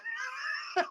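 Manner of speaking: shrieking burst of laughter; high laughing shriek. Real, not acted. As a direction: cackle up high. Laugh burst after shout.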